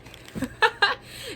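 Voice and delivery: short high burst of laughter. Real, not acted. Laughing at her own firm words to the spirits. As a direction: laugh at yourself.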